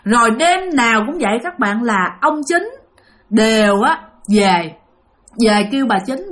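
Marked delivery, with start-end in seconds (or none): none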